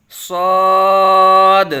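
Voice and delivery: loud, sustained صاد, thick and rounded after a sharp s; full-mouthed whistling Saad. Shown as a demonstration. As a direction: stretch a heavy صاد on one tone. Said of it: "پر یعنی موٹا کر کے پڑھیں گے".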